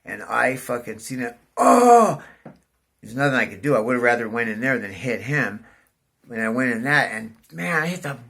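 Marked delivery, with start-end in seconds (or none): 1.6-2.2 sudden high, loud yelp of shock; startled cry. Retold or imitated. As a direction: blurt it out as the bike drops into the hole. Sudden pothole, bike slams in.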